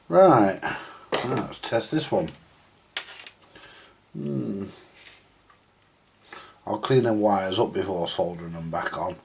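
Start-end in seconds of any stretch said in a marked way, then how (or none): none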